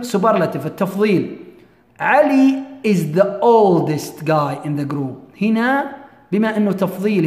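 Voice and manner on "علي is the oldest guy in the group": very slow model sentence; slow example reading. Shown as a demonstration. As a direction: read it out deliberately, word by word. Teacher reads superlative example.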